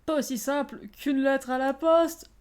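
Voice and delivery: milk the corny punchline; proudly overplayed pun; hammy punchline. Hammy echo, then mock laughter.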